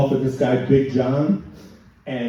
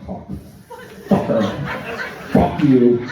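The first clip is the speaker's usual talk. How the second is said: imitated tic outburst; much slower than usual. Imitating client's tic again.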